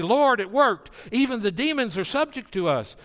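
thrilled followers reporting back; pumped-up disciples. Retold or imitated. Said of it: "They're really pumped up".

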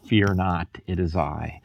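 calm them as if over the storm; Jesus soothing Peter amid the crashing waves, softly; tender reassurance. Acted. Jesus speaking over the chaos.

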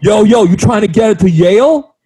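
high, loud mock rapper; rich kid playing gangster. Ridiculing a Yale-bound rich kid, mock gangster. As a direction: hype it up like a wannabe rapper.

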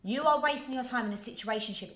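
ordinary talk

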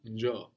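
"njo" with its double O accented on the first half. Shown as a demonstration. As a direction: stress the first O of the long "njo". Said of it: "the accent goes on the first one".

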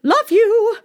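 high, breezy posh goodbye; airy queen farewell. A narrator's reading. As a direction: toss it off lightly as the queen. Queen leaving the daughter.

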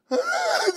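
very high, loud comic sob; mock crying wail. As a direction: burst into exaggerated pretend bawling. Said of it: "I'm ready to cry again".

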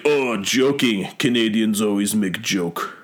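put-on slow Russian-accented English; imitated Russian accent. Russian fan answering Canadian's excuse, imitated Russian accent. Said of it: "he speaks Russian".

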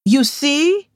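high, bright-rising told-you-so; excited cry. A narrator's reading. Yemisi to friends proving her point, high excited cry. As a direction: crow that you were right. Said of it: "Yemisi cried".

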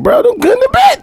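squeaky laughing voice; laughing high. Retold or imitated. Mocking reply, laughing high.

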